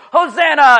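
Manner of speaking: loud joyful acclamation; festive shout. Retold or imitated. Crowd in Jerusalem greeting a newcomer, shouting. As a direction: call it out to a king arriving. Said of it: "they'll go".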